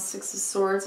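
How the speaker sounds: normal talking voice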